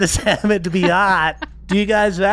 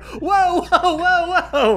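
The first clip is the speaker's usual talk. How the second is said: high, laughing crowd whoa; 10 semitones higher, much slower than usual. Acted. A crowd reacting to the wrestling, high and laughing. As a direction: gasp it high like a shocked crowd. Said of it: "you hear just everyone instantly like".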